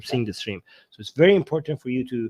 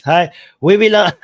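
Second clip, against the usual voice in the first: loud yell breaking off with a sick throat; trying to yell; 3 semitones higher, 11 dB louder than usual. Real, not acted. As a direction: attempt a shout and cut off. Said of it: "I'm trying to l-, yell, I can't".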